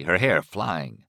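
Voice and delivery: plain reading voice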